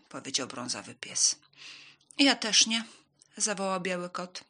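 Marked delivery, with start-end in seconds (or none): 2.2-2.8 higher, loud storybook cat; loud, higher refusal; refusing cat. A narrator's reading. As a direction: chime in high and loud as the cat.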